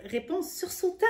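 plain reading voice

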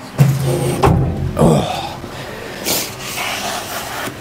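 long strained grunt while lifting; effort grunt. Real, not acted. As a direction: grunt with effort under heavy weight. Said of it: "Sorry for the grunting there".

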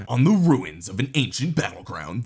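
booming epic trailer voice; announcer voice. Shown as a demonstration. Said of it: "let me put on my announcer voice".

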